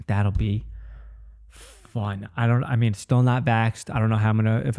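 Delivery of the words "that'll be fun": deflated. Real, not acted. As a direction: let the excitement drain out. Enthusiasm drains after the excitement.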